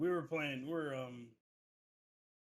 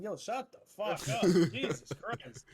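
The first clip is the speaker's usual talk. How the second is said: high, exasperated retold outburst; exasperated mock yell; 5 semitones higher, 8 dB louder, slower than usual. Telling a drunk friend off in a story, exasperated. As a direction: act fed up, pitching it up high.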